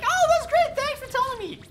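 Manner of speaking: very high, loud put-on thanks; mock gushing reply. Streamer imitating his reply to a fan in a very high put-on voice. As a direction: overdo the delighted thank-you. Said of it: "I'm like".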